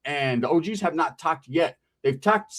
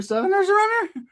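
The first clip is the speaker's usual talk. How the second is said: cousin Ricky voice. Retold or imitated. Cousin Ricky pulling him aside, in a high squeaky voice.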